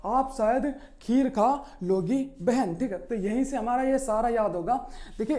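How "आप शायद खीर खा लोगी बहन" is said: slowly, word by word; slow, deliberate classroom recital; slow mnemonic sentence. Shown as a demonstration. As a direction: spell out the memory line slowly so it sticks. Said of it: "क्या बोला?".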